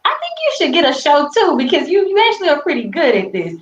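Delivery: high, bright quoting voice; quoting a friend. Retold or imitated. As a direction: lift the voice high for her friend. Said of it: "she's like".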